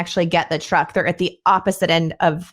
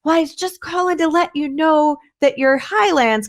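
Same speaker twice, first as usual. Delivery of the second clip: higher, strained neighbor voice; laryngitis voice; 8 semitones higher than usual. Retold or imitated. Neighbor reporting loose cows, strained high imitation. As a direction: mimic the sick neighbor straining to talk. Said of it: "And he's like".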